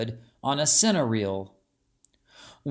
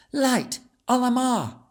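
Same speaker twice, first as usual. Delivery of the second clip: put-on much higher child incantation; exclaimed spell; 10 semitones higher, 3 dB louder than usual. A narrator's reading. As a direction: cry out the spell. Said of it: "she exclaimed".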